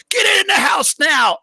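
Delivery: imitated yell. Streamer imitating the mother yelling at her kid. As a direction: bark the order like the mother. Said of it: "her tone switches".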